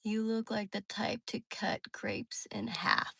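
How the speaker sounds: slow breathy whisper, barely voiced. Real, not acted. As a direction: whisper it slowly, almost unvoiced. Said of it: "reads chat comment in whisper".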